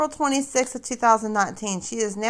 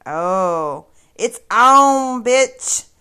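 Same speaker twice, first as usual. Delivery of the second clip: taunting call-out; 3 semitones higher, 7 dB louder, much slower than usual. Real, not acted. Taunting challenge to the viewers.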